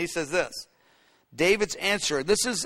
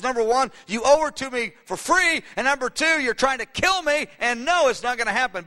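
higher-pitched indignant point-by-point refusal; angry refusal; 6 semitones higher than usual. Acted. Cheated suitor telling Saul no, angry. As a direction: lay down your objections, indignant. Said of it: "Most guys would be so angry".

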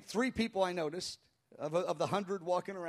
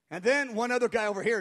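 ordinary delivery

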